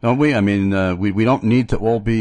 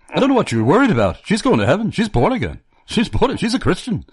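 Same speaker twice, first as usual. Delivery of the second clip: put-on high-pitched thick Welsh accent; lively Welsh accent, pitched high; Peter Jeffrey's thick Welsh accent; 12 semitones higher, faster than usual. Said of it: "said with his thick Welsh accent".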